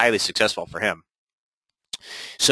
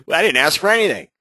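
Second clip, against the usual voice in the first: high, amused homeowner grumbling at the closed door; mock homeowner; 7 semitones higher, 4 dB louder, faster than usual. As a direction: play the puzzled homeowner, pitched up.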